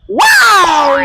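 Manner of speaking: loud high whoop of delight; refreshed shout. Acted. After a cold drink, he whoops loudly. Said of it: "Kailangan dito talaga sisigaw?".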